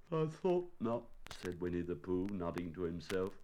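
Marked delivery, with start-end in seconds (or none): 0.1-1.0 high, small bear voice; disappointed bear. Acted. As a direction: pitch it high for a resigned bear. Voices Pooh nodding to himself.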